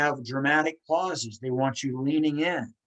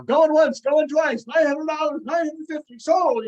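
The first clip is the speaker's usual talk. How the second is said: high, loud rapid auction call; auctioneer voice; 13 semitones higher, 6 dB louder than usual. Retold or imitated. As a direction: rattle off bids like an auctioneer. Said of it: "Maybe if you're gonna be an auctioneer".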